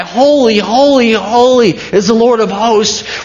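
holy, holy called out high and loud; angels' cry. Retold or imitated. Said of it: "and cry".

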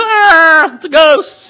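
very high, loud hammed-up shriek; mock terrified scream. Disciples seeing Jesus on the water, mock terrified scream. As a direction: shriek it in comic panic.

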